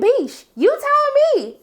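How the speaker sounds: very high, slow, loud sassy drawl; sassy clapback. Retold or imitated. Lexi snaps back at Cassie, very high slow sass. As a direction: stretch it out, full sass. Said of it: "She was like".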